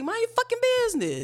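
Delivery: high pitched scolding burst. Real, not acted. Telling off nosy critics in a high scolding voice. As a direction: scold them sharply, up high. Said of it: "telling off critics".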